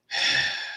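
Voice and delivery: short voiceless sigh. Real, not acted. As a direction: let out a soft breath. Exasperated pause in his rant.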